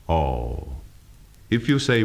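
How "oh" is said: "Oh" low and gliding down; disappointed. Shown as a demonstration. Teacher demonstrating downward glide, disappointed "Oh". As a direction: let "Oh" sink in disappointment. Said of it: "in a disappointed manner, and the voice will glide down".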